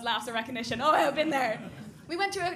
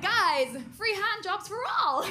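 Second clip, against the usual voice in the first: loud, higher call to the whole group; calling out to the lads; 7 semitones higher, 5 dB louder, slower than usual. Retold or imitated. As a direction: shout it across the street, slow and grand. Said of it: "I was like".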